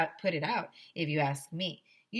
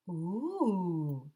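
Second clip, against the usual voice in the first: a lightly voiced class ooh; impressed ooh. Kids in assembly going ooh at firefighters. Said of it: "we all went".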